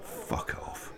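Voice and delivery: unvoiced whisper under the breath; hushed parting shot; whispered curse. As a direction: whisper a sullen brush-off. Sullen parting shot after quarrel.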